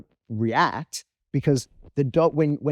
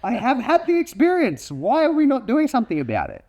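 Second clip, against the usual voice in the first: high-pitched, incredulous protest; comic outrage as a disbelieved dog; indignant dog; 10 semitones higher than usual. Acted. Dog arguing with owner about a serious threat, high indignant protest. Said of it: "because the dog's like".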